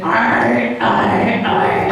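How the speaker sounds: rough throaty growled arr; gravelly pirate arr. Shown as a demonstration. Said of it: "and then you just get".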